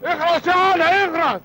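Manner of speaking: high, slow shout to shut up; shouting at nephew; angry order. Acted. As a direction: silence him harshly.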